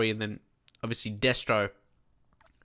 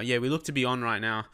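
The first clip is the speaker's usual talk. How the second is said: ordinary talk, nothing marked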